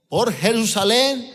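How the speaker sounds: ordinary talk, nothing marked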